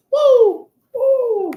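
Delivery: high, loud hype-up cheer; excited cheer. Real, not acted. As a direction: whoop it up, almost cracking. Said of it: "I almost voice cracked".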